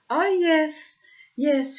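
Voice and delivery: high, loud, drawn-out gloating; hammed-up villainous relish; mock sinister. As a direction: act out a menacing taunt. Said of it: "it sounded really sinister".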